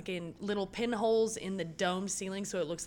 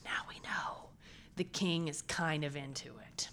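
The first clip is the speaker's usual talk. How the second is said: conspiratorial whisper; 4 semitones lower, 5 dB quieter than usual. Real, not acted. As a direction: lean in and whisper the secret. Conspiratorial aside.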